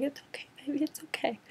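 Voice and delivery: imitating a mother comforting her daughter; mock soothing mom. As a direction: hush her like a baby. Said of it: "gives her a hug".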